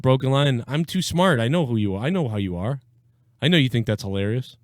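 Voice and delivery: ordinary delivery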